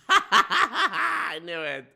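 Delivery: cracking up as you say it; laughing. Real, not acted. Streamer bursts out laughing.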